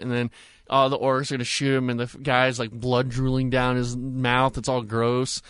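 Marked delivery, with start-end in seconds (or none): none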